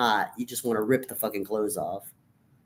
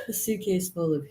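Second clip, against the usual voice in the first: laughing; 7 semitones higher, 4 dB quieter than usual. Real, not acted. Laughing through words.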